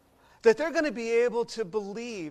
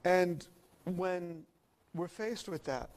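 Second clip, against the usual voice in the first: ordinary delivery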